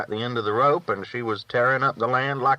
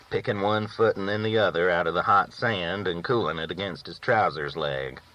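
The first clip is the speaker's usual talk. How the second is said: ordinary delivery, nothing marked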